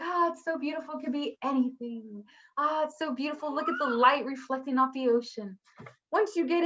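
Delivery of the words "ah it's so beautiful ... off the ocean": soft, wistful admiring; dreamy awe. Retold or imitated. Admiring the ocean before getting in, hushed wonder. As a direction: gaze at a beautiful sea, softly. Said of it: "you're like".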